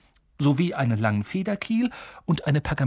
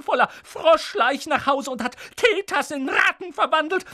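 hohe, keifende Schimpftirade; schimpfend; 14 semitones higher than usual. A narrator's reading. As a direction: lass die Wut aller Jahre raus. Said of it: "fing dann erneut an zu schimpfen". (Tante Petunia schimpft über ihre Schwester)

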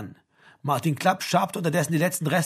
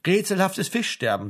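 higher, relishing the words with sly malice; malicious smile; 6 semitones higher than usual. A narrator's reading. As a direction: say it through a wicked little smile. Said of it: "lächelte boshaft". (boshaft lächelnd)

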